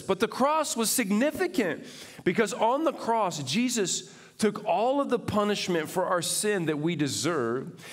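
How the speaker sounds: high, loud, fervent preaching; full-force preaching. Acted. Pastor preaching to the congregation, fervent and loud. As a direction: preach it out to the whole room.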